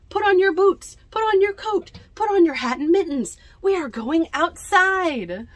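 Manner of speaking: loud, high eager urging; excited children. Acted. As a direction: hurry everyone out to the snow.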